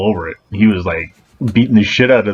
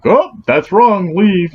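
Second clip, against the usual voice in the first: high, slow sneering mockery; mocking managers; 12 semitones higher, 3 dB louder, much slower than usual. Guard mocks office managers who fire staff, slow high sneer.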